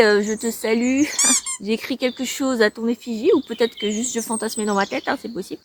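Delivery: ordinary talk, nothing marked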